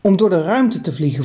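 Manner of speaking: plain reading voice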